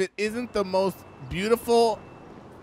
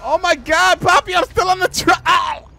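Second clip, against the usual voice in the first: much higher, loud frantic shouts; panicked yelling; 10 semitones higher, 7 dB louder than usual. Acted. Woman shouting at Poppy from the truck. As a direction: scream at Poppy to stop.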